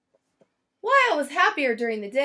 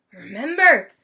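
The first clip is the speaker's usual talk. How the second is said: louder, emphatic reminder; Mister Grumps; 12 dB louder than usual. Acted. Mister Grumps reminding Valentini at the farewell party, emphatic. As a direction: press the point warmly.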